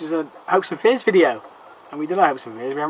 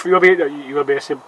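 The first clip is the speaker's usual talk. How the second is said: ordinary delivery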